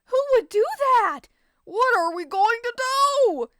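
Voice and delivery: high, loud clamour of voices; a crowd shouting over each other; panicked crowd. Acted. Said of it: "The crowd spoke at the same time".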